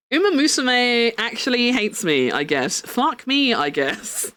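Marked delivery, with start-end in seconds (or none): none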